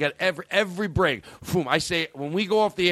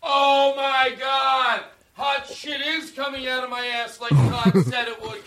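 much higher, stretched-out hammy exclamation; mock amazement; 11 semitones higher than usual. Host hams up amazement at the cohost's trick, high and drawn out. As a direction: overplay the shock for laughs.

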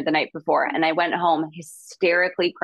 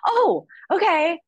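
stunned surprise; 13 semitones higher, slower than usual. Retold or imitated. Told she booked the role.

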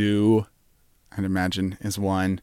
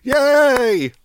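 much higher, bouncy Yay rhyming Yay; mock cheering Jimmy; much slower than usual. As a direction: cheer like Jimmy clapping along. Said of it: "he'll do this clap thing".

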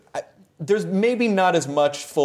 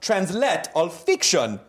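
hammed-up Italian fan accent; 6 semitones higher than usual. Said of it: "I'm gonna do another accent".

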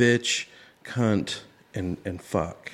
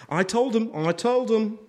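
mock smug host; 10 semitones higher than usual. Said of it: "It's like".

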